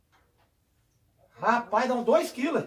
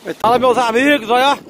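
usual speaking voice

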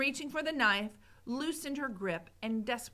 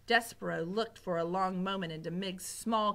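plain reading voice

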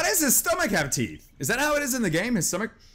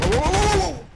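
high, loud string of whoas; startled high outburst; alarmed; 10 semitones higher, 5 dB louder than usual. Real, not acted. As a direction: yelp in sudden alarm. Startled by game event.

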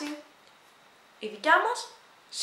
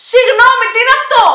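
much higher and louder, incredulous; baffled outcry; 11 semitones higher, 16 dB louder than usual. Real, not acted. Vlogger baffled by a foreign phrase, shouting in disbelief. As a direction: cry out in disbelief at the strange words.

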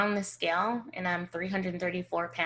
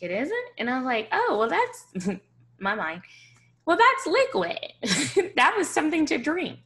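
much higher, laughing, caught-out excuses; flustered surprise; 9 semitones higher, 5 dB louder than usual. Retold or imitated. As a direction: squeak out the excuse between laughs. Caught out over coffee.

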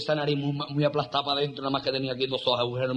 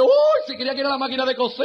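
loud-ish, high mock astonishment; camp friend; 7 semitones higher, 4 dB louder than usual. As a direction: squeal the punchline effeminately. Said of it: "Llega otro maricón y dice".

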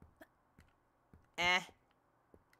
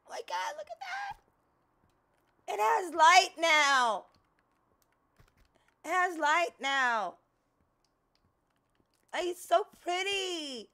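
awed; 8 semitones higher, 4 dB louder than usual. Real, not acted. Marvelling at the light.

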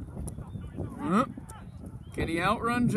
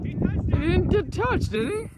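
indignant disbelief; 10 semitones higher, 4 dB louder than usual. Real, not acted. Protesting the call.

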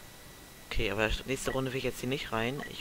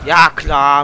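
sarcastic; 16 dB louder than usual. Real, not acted. Sarcastic loud reaction in game.

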